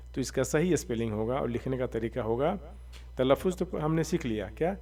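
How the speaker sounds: ordinary delivery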